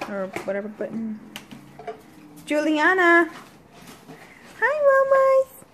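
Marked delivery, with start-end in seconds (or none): 2.5-3.3 calling out. Real, not acted. Calling out to daughter. 4.6-5.6 bright high-pitched hello, drawn out; excited high greeting. Real, not acted.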